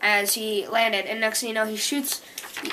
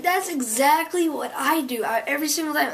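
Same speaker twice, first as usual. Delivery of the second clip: excited recognition; 5 semitones higher than usual. Real, not acted. Reader spots own habit in comic-book boy, excited. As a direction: gush at how relatable it is.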